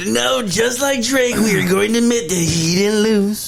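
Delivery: high, slow put-on voice; hammed-up teasing voice; mock voice. Mocking [name] fan voice.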